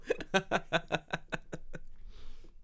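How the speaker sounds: a long airy laugh; breathy laugh. Real, not acted. Laughing at cohost's depression joke. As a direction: laugh it out helplessly.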